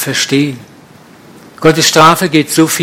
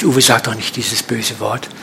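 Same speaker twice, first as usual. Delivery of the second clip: lower, softer mock reproach; a hint of a disapproving congregant; scolding quote; 5 semitones lower, 6 dB quieter than usual. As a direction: imitate a prim churchgoer's gentle rebuke. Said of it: "Ich krieg immer geschimpft".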